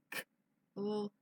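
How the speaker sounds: usual speaking voice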